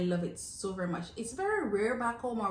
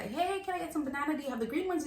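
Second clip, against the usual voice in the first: higher, sing-song customer asking; lightly acted polite question, pitched up; friendly request; 7 semitones higher, faster than usual. Customer asking the market guy for bananas, friendly request.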